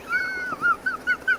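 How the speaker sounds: a high wheezy cackle; high cackling laugh. Real, not acted. Veteran laughing at the prank on Gnarls, with a high cackle. Laughing at his own story.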